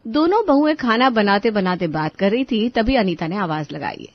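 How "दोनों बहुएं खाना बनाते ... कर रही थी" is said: usual speaking voice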